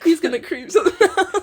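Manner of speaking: fast, giggling innuendo; snickering at the dirty joke; laughing. Real, not acted.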